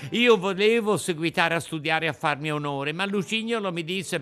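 high, urgent puppet voice blaming his friend; a guilty puppet protesting it isn't his fault; excited high wooden boy; Pinocchio pleading. A narrator's reading.

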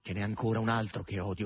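plain reading voice

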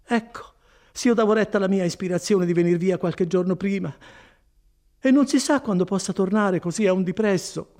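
high, fretful woman's voice; vexed, high-pitched complaint; regretful Agnese. Acted.

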